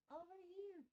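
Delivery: high soft coaxing; squeaky pet voice. Acted. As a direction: coax the cat over in a tiny voice. Calling the cat.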